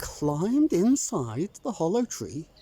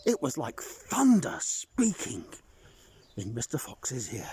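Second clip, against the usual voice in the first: usual speaking voice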